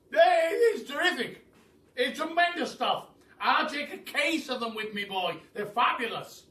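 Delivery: put-on high, loud, overjoyed old Duke; thrilled shout. The old Duke thrilled by his sweets, loud high shout. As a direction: bellow with delight at the sweets.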